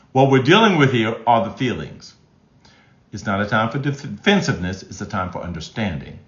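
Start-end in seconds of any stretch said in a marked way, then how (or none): none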